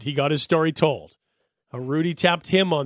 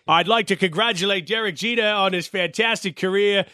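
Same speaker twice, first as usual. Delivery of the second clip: higher-pitched mock announcer with a Yankee accent; 5 semitones higher than usual. Mock announcer presenting Jeter's farewell gift, Yankee accent. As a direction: put on a Yankee announcer's ceremonial voice. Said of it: "had some sort of a Yankee accent".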